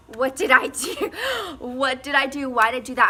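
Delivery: loud, laughing through the words; half-laughing, half-panicking at what you caused; mock-horrified laughing disbelief; panicked laughing. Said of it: "I'm like".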